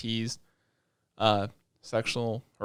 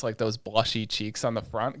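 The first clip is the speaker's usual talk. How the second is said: ordinary talk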